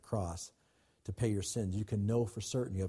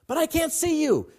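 put-on much higher, louder frightened boy's cries; panicked boy; 8 dB louder than usual. Boy on the burning roof calling to his father, panicked high cries. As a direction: shout blindly through the smoke. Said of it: "boy protesting again".

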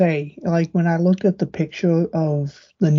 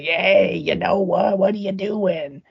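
gruff Elmo voice. Retold or imitated. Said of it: "very gruff, like, gringy voice".

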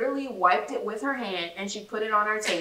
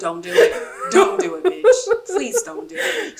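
high, loud laughing begging; laughing plea; 10 semitones higher, 8 dB louder than usual. Retold or imitated. Begging the waitress, high and laughing loud. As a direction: beg through laughter. Pleading in retold scene, laughing.

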